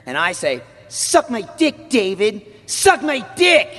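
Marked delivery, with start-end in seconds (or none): 0.9-2.4 higher, slow, bratty kid cartoon voice; Max character voice. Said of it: "that's how I get into character". 2.7-3.8 put-on higher, slow, louder bratty kid yell; Max character shout. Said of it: "that's how I get into character".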